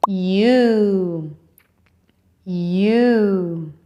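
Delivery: "U" drawn out as a long "yoo"; long-vowel "U", said slowly for learners; long U sound. Shown as a demonstration. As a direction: stretch each "U" into a held vowel. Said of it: "and it's long sound".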